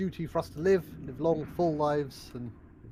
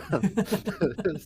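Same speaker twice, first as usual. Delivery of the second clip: giddy laugh; 2 semitones higher than usual. Acted.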